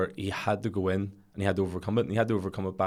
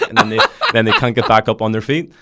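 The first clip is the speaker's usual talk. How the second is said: laughing; 2 semitones higher, 11 dB louder than usual. Real, not acted. Laughing at his own tall tale.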